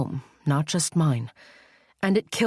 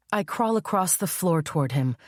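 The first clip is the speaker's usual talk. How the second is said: plain reading voice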